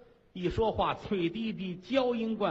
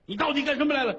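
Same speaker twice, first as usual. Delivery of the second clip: shouted, much higher and louder; furious demand; 9 semitones higher, 5 dB louder, much faster than usual. Acted. Queen catches the fleeing consort and roars at her. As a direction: roar the question at her.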